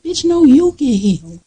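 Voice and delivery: high, sharp mock retort; sassy comeback. Telling off a friend who says to heal, high sassy retort. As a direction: fire it back, incredulous and sassy. Snapping back at unhealed friend.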